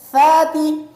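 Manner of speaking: "ساتی" as "thaati", th as in think; correct tha. Shown as a demonstration. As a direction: say "ساتی" with tongue tip between the teeth. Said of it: "زبان کی نوک کو سامنے والے دانتوں کے نیچے".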